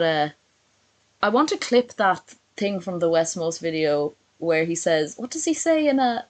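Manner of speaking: usual speaking voice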